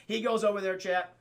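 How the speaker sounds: normal talking voice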